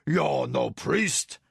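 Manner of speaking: slow, loud booming giant. A narrator's reading. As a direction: boom it out like a giant. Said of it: "boomed the giant".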